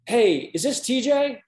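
high-pitched, drawn-out phone greeting; Stan Van Gundy. Retold or imitated. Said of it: "this is Stan Van Gundy".

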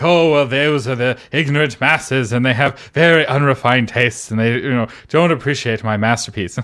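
light mock posh British accent, sneering at the masses; a hint of a snooty British accent, looking down on readers; arrogant British accent. Mocking a snobbish writer who scorns genre readers, in a lightly put-on arrogant British accent. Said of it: "little accent you were doing".

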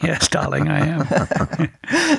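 slow, drawn-out grande dame reply; lightly put-on Hepburn drawl, slow; Katharine Hepburn voice. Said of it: "I'm starting to sound like Katharine Hepburn".

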